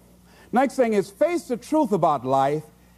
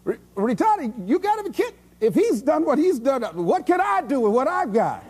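amazed onlooker; 6 semitones higher than usual. Retold or imitated. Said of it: "people who look at me and say".

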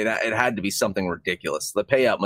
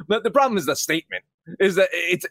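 ordinary delivery

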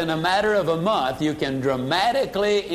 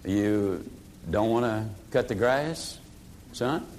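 lowered echo; 9 semitones lower, 7 dB quieter than usual. Shown as a demonstration. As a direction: hand the refusal back to the son calmly. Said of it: "you just look at him and simply say".

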